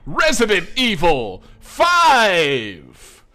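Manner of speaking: very slow, loud announcer shout. Pretend. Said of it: "That was the announcer you were going with".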